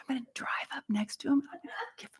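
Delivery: fast, hushed scheming; conspiratorial whisper. Retold or imitated. Teacher whispering her revenge plan on the driver. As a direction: whisper the plan like a naughty secret.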